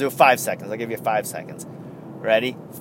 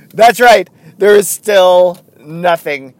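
ordinary talk

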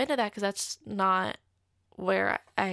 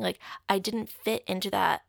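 ordinary delivery

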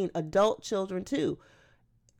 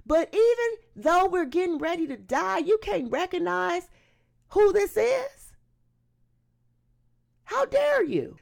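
high, loud, outraged challenge; indignant rebuke; 8 semitones higher, 4 dB louder than usual. Acted. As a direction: rebuke him hard, voice climbing to an appalled peak. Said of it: "The other criminal responded for him".

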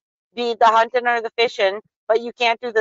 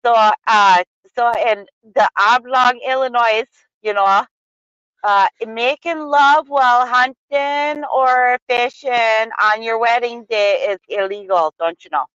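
Wisconsin accent. Shown as a demonstration. Explaining an odd Illinois law to a co-host, Wisconsin accent. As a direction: lay on a thick Wisconsin accent. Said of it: "with the Wisconsin accent if you want".

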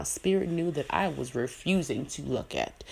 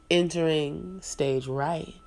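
ordinary delivery

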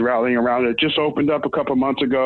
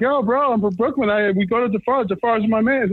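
higher, eager boast to the pizza guys; proudly claiming his Brooklyn roots; excited Brooklyn bro; 7 semitones higher than usual. Retold or imitated. Telling Tulsa pizza staff he's from Brooklyn, excited and higher. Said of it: "I'm like".

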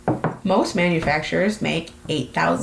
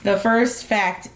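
usual speaking voice

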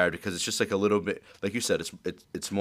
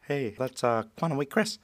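higher-pitched mocking impression; Quantum Week Chris; 6 semitones higher than usual. Host showing his wife a terrible impression, mocking voice. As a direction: lift the pitch, poking fun at his voice. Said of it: "I made fun of his voice".

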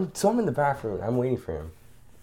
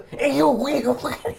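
excited; 4 dB louder, much slower than usual. Real, not acted. Laughing outburst mid story.

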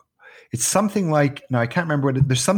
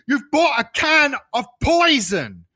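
slow, high wail of horror; hammed-up child's panicked accusation; freaked out; 13 semitones higher, 3 dB louder than usual. Son thinks the French can is poison, freaked out. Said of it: "freaked out".

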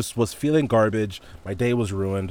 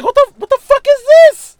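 shrieking high and loud in disbelief; stunned outburst; 13 dB louder than usual. Retold or imitated. Watching his ex's shisha video, a shrill loud disbelieving shriek. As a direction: blurt it out like you can't believe your eyes. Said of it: "I'm like".

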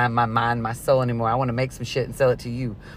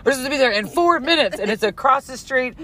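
frantic; 9 semitones higher, 3 dB louder than usual. Real, not acted. Retelling rushing to appointment.

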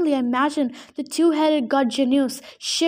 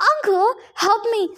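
desperate plea; 7 semitones higher than usual. A narrator's reading. Falling boy begs his uncle for help, high and desperate. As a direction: scream for help as you slip.

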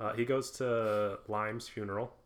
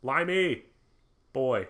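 louder, higher mock eager greeting; imitating Holly Martin; 4 semitones higher, 7 dB louder than usual. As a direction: call out like a pushy old pal. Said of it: "and he's like".

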